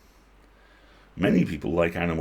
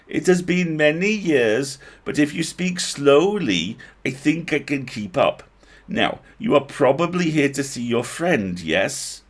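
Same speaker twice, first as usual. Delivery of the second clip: slow, high bad Welsh lilt; bad Welsh accent; 7 semitones higher, 6 dB louder than usual. A narrator's reading. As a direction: elf speaking carefully in a hammy Welsh accent. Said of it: "Bad Welsh".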